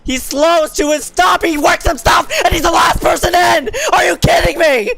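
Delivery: high, loud frantic race call; excited shouting. Real, not acted. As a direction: scream the finish in disbelief. Wild finish, commentator yelling.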